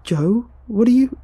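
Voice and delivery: high, loud, alarmed question; startled friend. A narrator's reading. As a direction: blurt it out in alarm. Startled friend about to be hit.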